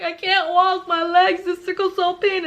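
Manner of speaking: high, wailing complaint; mock screaming in pain. Explaining sickle cell pain to friend, wailing. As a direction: wail it in put-on agony. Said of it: "I'm screaming because the pain is excruciating".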